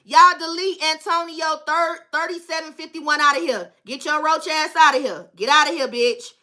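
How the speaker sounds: ordinary delivery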